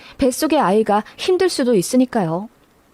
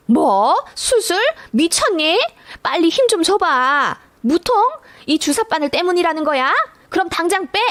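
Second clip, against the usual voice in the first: outraged mother-in-law; 6 semitones higher than usual. Retold or imitated. Mother-in-law refuses the C-section.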